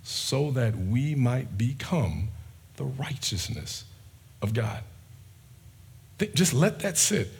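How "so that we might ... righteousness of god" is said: very slow, every word spaced out; said slowly. Shown as a demonstration. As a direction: let each phrase land slowly. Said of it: "I'll say it slowly".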